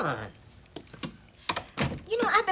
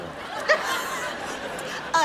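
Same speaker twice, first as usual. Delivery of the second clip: scoffing laugh. Acted. Scoffs at being called addicted.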